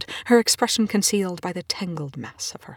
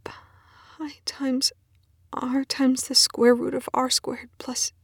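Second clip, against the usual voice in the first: slow, higher, toneless whisper; 7 semitones higher, much slower than usual. Acted. Colleague answering the scientist in a slow whisper. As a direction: murmur the formula half-dazed. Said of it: "she whispers back at him".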